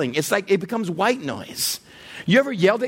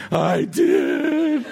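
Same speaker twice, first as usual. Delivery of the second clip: higher, put-on toddler voice; small child confessing; 4 semitones higher than usual. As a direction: own up like a little kid. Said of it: "he's two and a half".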